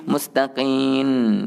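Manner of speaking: slow "mustaqim" ending in an n; drawn-out final n in "mustaqim"; mim like nun. Retold or imitated. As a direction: say "mustaqim" as "mustaqin", lips left open. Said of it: "Bunyi macam nun bunyi dia".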